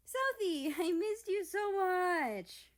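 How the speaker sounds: high, loud and slow gushing. Acted. Japan gushing while hugging South. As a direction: hug her with your voice.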